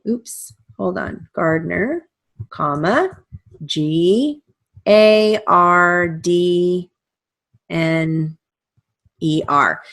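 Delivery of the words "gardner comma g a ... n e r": slow, letter-by-letter dictation; dictating. Shown as a demonstration. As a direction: spell it out for the class. Re-dictating the name spelled.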